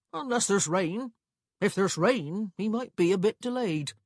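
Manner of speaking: relaxed clerk, slow on the key words; easygoing clerk. A narrator's reading. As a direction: explain the timetable casually, drawing out the times. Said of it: "said easily".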